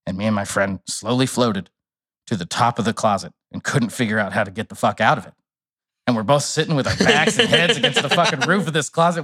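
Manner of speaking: normal talking voice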